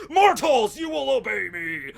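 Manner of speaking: high, loud, commanding god voice; booming god. Retold or imitated. Storm god proclaiming to mortals, booming command. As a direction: boom it down at the mortals.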